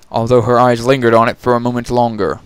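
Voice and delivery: ordinary delivery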